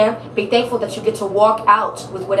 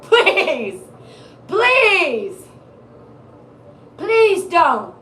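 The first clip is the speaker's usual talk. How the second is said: pleading; 7 semitones higher, much slower than usual. Acted. Begging client not to go back.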